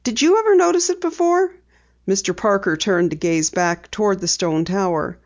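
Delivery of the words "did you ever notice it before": a hint of a bright young girl, higher; light girl voice for Penny; Penny, lightly voiced. A narrator's reading. Penny telling her dad she'll drive, lightly voiced girl.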